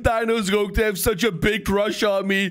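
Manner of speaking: ordinary talk, nothing marked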